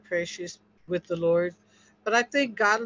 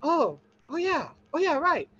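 higher, dawning "oh yeah" realization; a mock lightbulb moment, pitched up; sudden realization; 7 semitones higher than usual. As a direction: play the moment it finally clicks. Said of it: "the light bulb went out and went".

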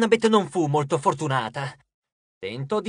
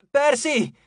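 high, urgent call; 8 semitones higher, 3 dB louder than usual. A narrator's reading. Annabeth calls to Percy from afar, calling out. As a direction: call out a name from a distance. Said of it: "La voce di Annabeth sembrava lontana".

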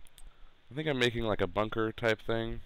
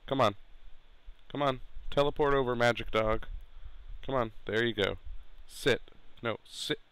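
normal talking voice

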